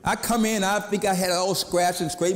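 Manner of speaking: normal talking voice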